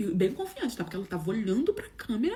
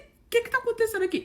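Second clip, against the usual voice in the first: baffled question; 11 semitones higher than usual. Retold or imitated. Perplexa com a mensagem.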